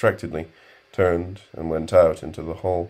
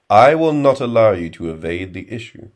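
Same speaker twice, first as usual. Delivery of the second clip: firm retort; 4 semitones higher, 8 dB louder than usual. A narrator's reading. Stoner cutting Walker off.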